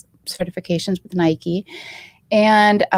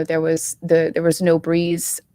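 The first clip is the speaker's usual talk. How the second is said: normal talking voice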